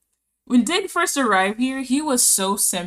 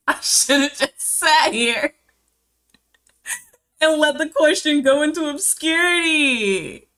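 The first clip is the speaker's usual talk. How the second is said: laughing regret; 6 semitones higher, 4 dB louder than usual. Real, not acted. Laughing self-mocking regret.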